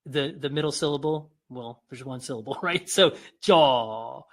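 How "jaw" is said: JAW elongated on a falling pitch; long, emphatic "jaw"; drawn-out demonstration. Shown as a demonstration. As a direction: let the word JAW stretch out. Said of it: "allow that word to elongate".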